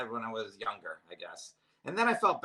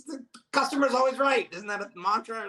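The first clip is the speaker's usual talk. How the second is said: loud, high-pitched comic objection; mock indignant protest; 11 semitones higher, 7 dB louder than usual. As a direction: protest like a wronged customer. Retold protest to the masseuse.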